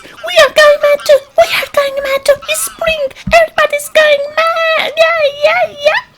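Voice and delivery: frantic springtime animal squeal; squeaky excited critters. Acted. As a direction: shriek it like crazed cartoon rodents.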